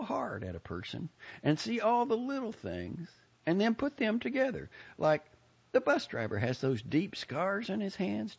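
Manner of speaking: high folksy lady, proud of her deductions; self-assured Southern busybody. Acted. Lady shows off her powers of perception, pleased chatter. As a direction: boast and explain in a high Southern character voice.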